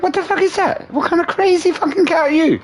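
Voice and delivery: very high-pitched incredulous squeal; acting out shock at a crazy cat; mock outrage. Outraged reaction to cat attack.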